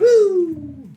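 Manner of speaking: loud, high "woo" of delight; celebratory whoop. Real, not acted. Player cheering the dice, high loud whoop. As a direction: let out a victory whoop.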